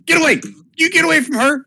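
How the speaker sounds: put-on high, loud child's yell; little boy. Game master as boy defending a girl, yelling. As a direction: shout high like an angry small boy. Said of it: "a little boy comes running out".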